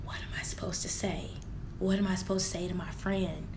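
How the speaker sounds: low, near-whispered helplessness; stunned hush. Retold or imitated. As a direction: barely voice it, at a loss for words. Stunned, helpless reaction, retold.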